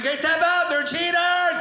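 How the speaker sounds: high, frantic warning yell; urgent call out. Acted. Comic warning his brother who is being choked, urgent shout. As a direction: call across the room in alarm.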